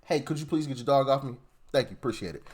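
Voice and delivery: soft, polite little ask; gentle, courteous request voice. Retold or imitated. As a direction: ask nicely and quietly, trailing off. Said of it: "that's a simple".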